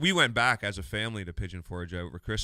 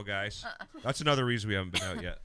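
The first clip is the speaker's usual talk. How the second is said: ordinary talk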